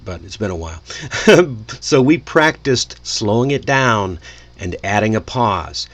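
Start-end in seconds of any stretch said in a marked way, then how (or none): none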